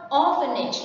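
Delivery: "orphanage" with the weight on OR-; ORphanage, as a model word; stress on first syllable. Shown as a demonstration. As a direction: hit the start of "orphanage", then fall. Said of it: "the stress is on the first one".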